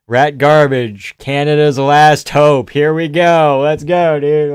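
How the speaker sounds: loud, slow ring-announcer hype; hype announcing. Real, not acted. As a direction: bellow it like a walkout call. Hyping the walkout of a fighter.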